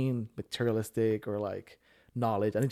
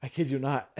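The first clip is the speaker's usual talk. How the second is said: normal talking voice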